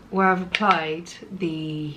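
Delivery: ordinary delivery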